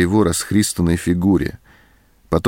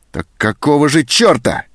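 loud, much higher angry shout; furious outburst; 8 semitones higher, 4 dB louder than usual. A narrator's reading. Sergeant raging at the girl, furious shout. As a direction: explode at her in rage. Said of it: "взъярился сержант".

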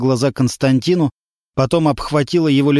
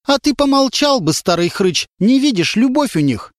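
high, sharp scolding; stern rebuke; 9 semitones higher than usual. A narrator's reading. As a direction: put the old man in his place. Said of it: "строго сказала". (строгий выговор старику высоким голосом)

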